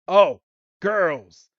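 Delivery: mock excited, loud swooping "oh"; imitation of a smitten Pokemon; smitten show-off. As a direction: play being suddenly girl-crazy, pitch falling on each word. Acts Golduck spotting girls.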